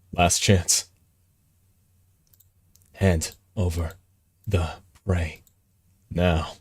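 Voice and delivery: low, slow, soft threat; hint of a threatening beast; lightly menacing cat. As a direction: lean in with quiet menace. Reading cat's threat as menace.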